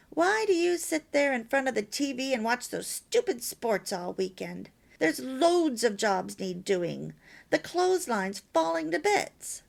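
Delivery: loud, scolding nag; nagging complaint. Shown as a demonstration. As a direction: berate him about the sports on TV. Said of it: "You can see the difference".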